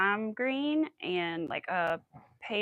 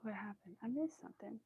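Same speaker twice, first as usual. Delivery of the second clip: normal talking voice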